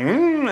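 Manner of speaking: high-pitched mock "mm"; imitated hum. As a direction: hum it up high, mimicking a wrestler. Mimics wrestler's pose noise.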